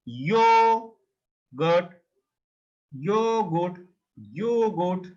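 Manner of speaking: "yogurt" opening on y, first vowel held long. Shown as a demonstration. Teacher shows how German J is pronounced, J as Y. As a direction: stretch "yogurt" into two separate syllables. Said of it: "as a English Y".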